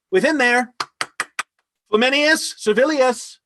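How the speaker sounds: loud, high summons; calling out. Acted. Lord summoning his servants from within, calling out. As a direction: call the servants in from another room.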